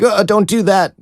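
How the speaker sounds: high-pitched imitation of a rattled protest; mock alarmed plea. Shown as a demonstration. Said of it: "but not like".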